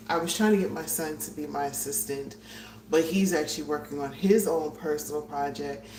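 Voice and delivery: ordinary delivery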